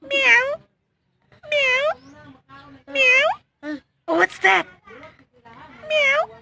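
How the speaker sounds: high meowing cat sound. Shown as a demonstration. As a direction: meow like a cat for a toddler. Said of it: "what is the sound of miming or the cat".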